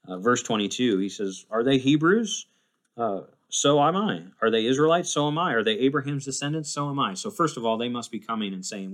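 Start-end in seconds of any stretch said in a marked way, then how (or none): 1.5-7.1 louder, higher rhetorical questions and answers; defiant challenge. Acted. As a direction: throw the challenge back, matching every claim. Paul defiantly matching rivals' credentials.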